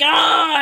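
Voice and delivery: high acted "ah"; bitten wrestler's yell. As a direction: cry out as if bitten. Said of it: "he's like".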